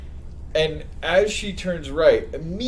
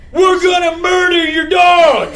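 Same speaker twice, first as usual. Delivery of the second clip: slow, high, very loud retold yell; acted-out screaming match; screaming threat; 13 semitones higher, 9 dB louder than usual. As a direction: bellow the warning word by word. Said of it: "We're just screaming at each other".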